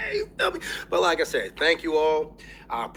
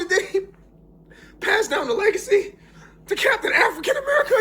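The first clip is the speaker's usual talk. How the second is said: normal talking voice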